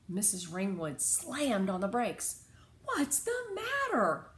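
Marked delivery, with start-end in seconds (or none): 2.8-4.3 high, slowed startled question; alarmed cry. A narrator's reading. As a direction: ask in sudden alarm. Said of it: "she cried".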